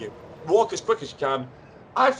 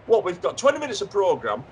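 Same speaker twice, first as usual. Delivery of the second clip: urgent hurrying; 7 semitones higher than usual. Acted. Hurrying late guest by phone.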